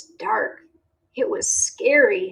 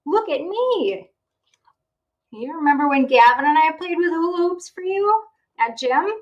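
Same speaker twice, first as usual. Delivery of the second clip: excited; 7 semitones higher, 3 dB louder, faster than usual. Acted. Clark shows off to mother.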